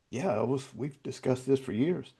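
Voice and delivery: softly puzzled reply, played back. Friend answering [name]'s question about God, softly puzzled. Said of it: "I just kinda puzzled at him".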